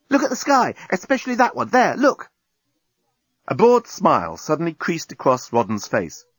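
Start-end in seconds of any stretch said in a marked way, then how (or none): 0.1-2.3 high, urgent pointing-out; excited. A narrator's reading. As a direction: insist they look, pitched up. Cried Nettie excitedly.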